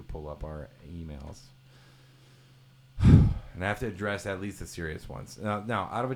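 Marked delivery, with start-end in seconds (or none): 3.0-3.5 loud, heavy exhale; weary sigh. Acted. Host burdened by listener emails, loud weary sigh. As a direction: let out a big put-upon sigh.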